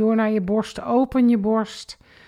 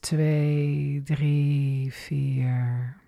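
counting slowly, sinking lower and fading softer; exhale count; 5 semitones lower, 4 dB quieter, much slower than usual. Shown as a demonstration. Instructor counting the out-breath, sinking and fading. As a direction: let the count fall away with the breath. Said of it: "En uit".

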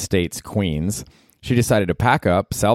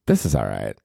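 unimpressed mutter; 9 semitones lower than usual. Retold or imitated. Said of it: "I was like".

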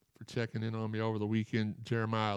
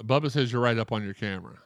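ordinary talk, nothing marked

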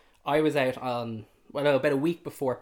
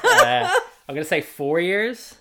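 laughing; 9 semitones higher, 13 dB louder, slower than usual. Real, not acted. Laughing while guessing age gap.

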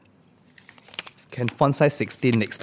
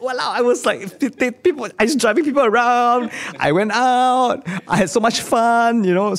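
excited; 9 semitones higher than usual. Real, not acted. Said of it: "excited memory of army fun".

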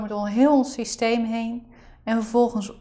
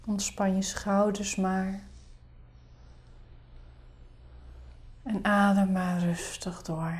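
soft spa whisper; 3 semitones lower, 9 dB quieter than usual. Acted. Leads a guided meditation.

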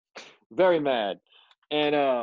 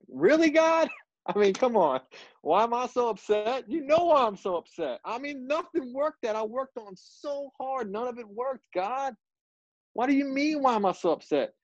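high-pitched exasperated protest to God; worked-up, incredulous retort; aggravated; 10 semitones higher than usual. Retold or imitated. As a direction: argue back at God, voice pitched way up. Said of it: "I'm just sitting there aggravated".

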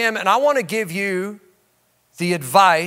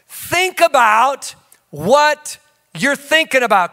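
slow, stressed, louder call to remember; emphatic preaching; 6 semitones higher, 4 dB louder, slower than usual. Shown as a demonstration. Pastor drilling the key phrase into the congregation, slow and emphatic. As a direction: lean on every word, slower. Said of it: "Come on, say that".

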